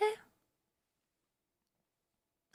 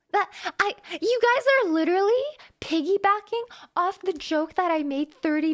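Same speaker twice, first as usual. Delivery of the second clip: exasperated; 4 semitones higher, 5 dB louder than usual. Real, not acted. Exasperated protest at chat.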